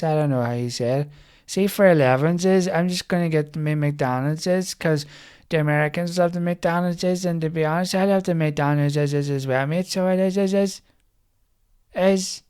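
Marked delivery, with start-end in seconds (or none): 1.5-12.4 higher-pitched mock local lads; wee guys. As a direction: imitate the wee guys, stretching every ending. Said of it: "like the wee guys who go".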